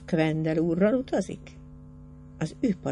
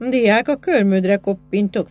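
threatening; 3 semitones higher, 7 dB louder than usual. A narrator's reading. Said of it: "fenyegette meg Fabricius Quendel".

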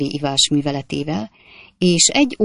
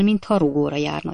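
ordinary delivery

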